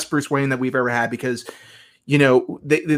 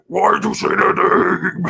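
slow, higher, strained Batman rasp; 6 semitones higher than usual. Retold or imitated. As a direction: push a hammy Bat voice. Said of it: "the Bat voice where he's like".

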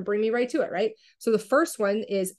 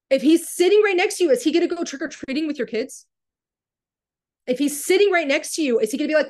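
high, loud, insistent challenge; heated, worked up; 6 semitones higher, 5 dB louder, faster than usual. Real, not acted. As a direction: press the question hard, worked up. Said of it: "sorry if I sound heated and worked up".

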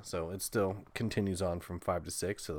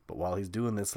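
ordinary delivery

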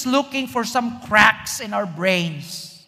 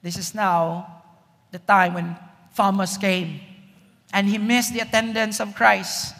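ordinary talk

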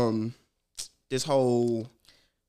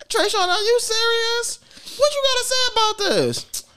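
mother rounding on her son in disbelief; angry mom. Retold or imitated.

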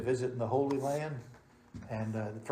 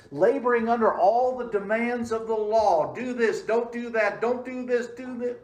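louder, higher fervent preaching; preaching at full force; 9 semitones higher, 7 dB louder than usual. Acted.

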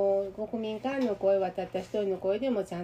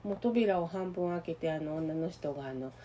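ordinary talk

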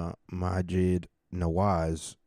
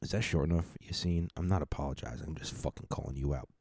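ordinary talk

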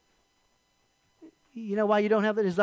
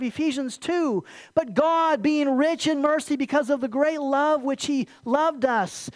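raised, ringing reading of the verses; scripture reading; 4 semitones higher, 3 dB louder than usual. Acted. Pastor reading Psalms to the church, ringing. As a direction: proclaim the verses to the congregation.

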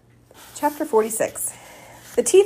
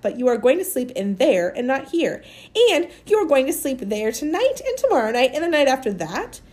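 higher-pitched, emphatic, no-argument command; bossy ordering; 5 semitones higher, 3 dB louder than usual. Acted. Amanda orders Maniac to come home with her, fierce insistent command. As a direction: lay down the law, stressing word after word.

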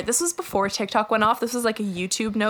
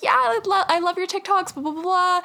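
high-pitched fangirl chatter; imitated gushing fan; 9 semitones higher than usual. Girl praising her TikToks outside the bar, gushing. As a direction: gush it in a much higher voice.

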